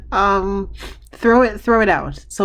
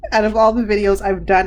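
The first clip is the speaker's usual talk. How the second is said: ordinary talk, nothing marked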